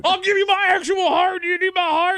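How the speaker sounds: high, loud mock devotion; desperate admirer. Host mocking men offering the fake woman a heart, desperate shout.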